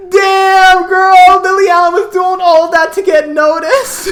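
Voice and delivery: high, loud hysterical exclamation; incredulous punchline. Retold or imitated. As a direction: belt out astonished disbelief while cracking up. Incredulous punchline amid laughter.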